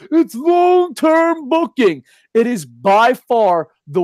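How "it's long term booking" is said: high, drawn-out mimicry of a fan; mock the fans, stretching it out high and slow; exaggerated high-pitched parroting. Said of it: "here comes all these New Japan fans".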